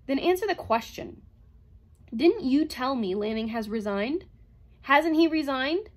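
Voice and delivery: loud, insistent, slowing at end; angry demand. A narrator's reading. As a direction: press hard for the answer. Bogart demands answer from robot.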